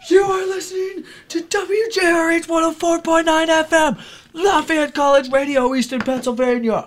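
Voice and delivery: radio ID yelled at full force, high; screaming. Shown as a demonstration. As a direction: scream the station ID like at a bar. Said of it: "I scream it at people at bars".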